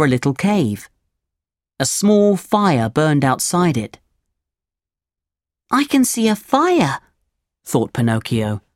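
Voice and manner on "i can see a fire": lightly voiced puppet boy. Pinocchio voiced in story.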